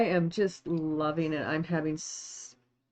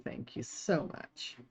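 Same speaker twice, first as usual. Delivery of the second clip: whispered thanks; 8 semitones lower, 9 dB quieter than usual. Real, not acted. Overwhelmed by a viewer gift.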